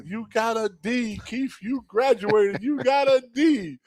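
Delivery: high and slow, beaming praise; high proud coach voice. Retold or imitated. Coach telling the player he graduated, high and slow. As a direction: stretch out the praise.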